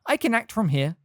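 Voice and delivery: high squeaky voice; small excited old wizard; squeaky little professor. A narrator's reading. Flitwick speaking, high voice.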